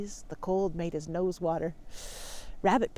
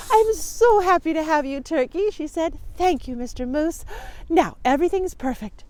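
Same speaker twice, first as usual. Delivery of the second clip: higher, louder joyful welcome; delighted moose wife; 7 semitones higher, 5 dB louder than usual. Acted. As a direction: gush with happiness to your guest.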